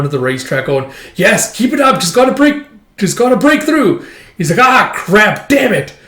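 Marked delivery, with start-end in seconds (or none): 1.2-4.0 high, loud racetrack cheer; excited cheering. Retold or imitated. Voices excited bettor at racetrack. 4.6-5.9 high, loud mock cursing; frustrated outburst. Voices bettor losing the race.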